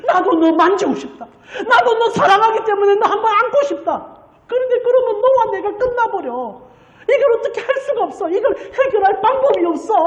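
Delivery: high, tearful lament breaking into a cry; God sobbing. Retold or imitated. God weeping to Moses, high sobbing lament. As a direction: ache it out as if weeping openly. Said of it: "하나님 펑펑 우는 거예요".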